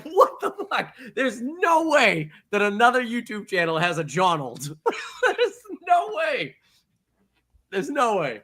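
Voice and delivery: high, slow, laughing what the fuck; laughing incredulously at a rival channel's Johnald; laughing disbelief. Retold or imitated. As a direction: giggle through the disbelief. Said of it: "I was like".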